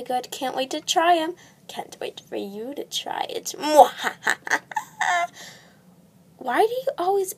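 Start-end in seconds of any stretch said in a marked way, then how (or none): 1.7-3.5 soft scheming whisper; sinister whisper. Acted. Witch sinister aside. 3.6-5.5 very high wicked cackle; evil laugh. Acted. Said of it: "do an evil laugh after every sentence".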